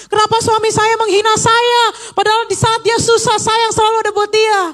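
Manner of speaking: high, pleading whine to God; whiny complaint. Pretend. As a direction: complain to God like a hurt wife. Mock complaint prayer to God. (keluhan merengek dengan nada tinggi kepada Tuhan)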